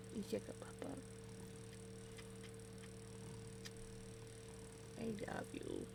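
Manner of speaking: soft whispered love to a pet; sweet nothings. Real, not acted. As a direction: whisper tenderly to the dog.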